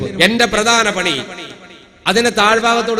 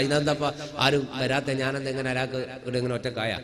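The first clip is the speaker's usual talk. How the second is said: much softer, fretting under his breath; muttered inner worry, soft; worried muttering to himself; 7 semitones lower, 12 dB quieter than usual. Retold or imitated. Worried thoughts while waiting alone. (സ്വയം പിറുപിറുക്കുന്ന ആശങ്ക)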